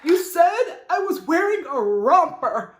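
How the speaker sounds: mock distraught, high and slow overreaction; Teddy. Teddy confronting Sutton over the romper remark, overwrought. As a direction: wail it in exaggerated outrage. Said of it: "You know how Teddy overreacts for everything".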